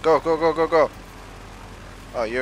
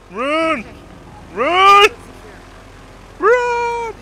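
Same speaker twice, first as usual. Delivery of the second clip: building shout; 7 dB louder, much slower than usual. Real, not acted. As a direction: shout louder each time. Urging teammate to run away.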